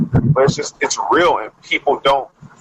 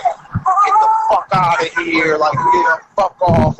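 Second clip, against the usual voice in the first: imitating police; 5 semitones higher, slower than usual. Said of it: "them just being like".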